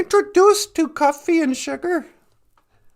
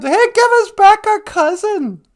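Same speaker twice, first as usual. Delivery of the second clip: loud, high demand at a stranger; shouted demand from horseback; angry shout; 8 semitones higher, 8 dB louder than usual. Retold or imitated. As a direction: yell it like an angry rider. Angry riders demand their cousin.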